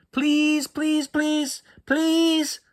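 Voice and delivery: high, whiny begging please; squeaky drawn-out pleading; pleading child. Acted. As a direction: beg like a kid who wants it badly.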